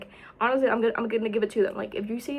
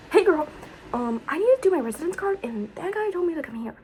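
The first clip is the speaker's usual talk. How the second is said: chirpy greeting; 7 semitones higher than usual. Retold or imitated. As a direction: greet her brightly like a friend. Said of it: "and I'm like".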